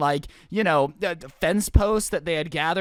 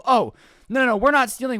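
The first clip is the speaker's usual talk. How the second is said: higher, louder, overeager mock protest; hurried denial; 4 semitones higher, 4 dB louder than usual. Kids accused of stealing gas, mock innocent protest. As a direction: protest innocence, badly faked. Caught kids deny the theft.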